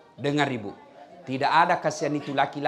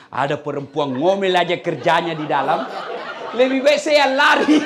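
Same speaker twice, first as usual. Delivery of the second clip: fleeing jinn; 2 semitones higher, 8 dB louder than usual. Acted. Jinn flees the nagging wife.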